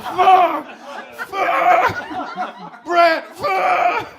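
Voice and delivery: very high, loud strained cursing; hammed-up screamed climax; acted climax yell. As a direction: shout it out at the top of the voice. Acting man crying out at climax.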